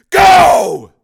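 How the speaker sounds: high, very loud, drawn-out yell; full shout. Acted. Father urges woman to run, full shout. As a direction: bellow the command.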